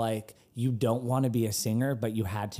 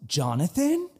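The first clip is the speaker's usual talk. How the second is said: mild mock yell, pitched higher; higher questioning call, only half shouted; retold yell; 6 semitones higher, 4 dB louder than usual. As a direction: imitate someone calling out a name in recognition. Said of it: "Somebody literally yells".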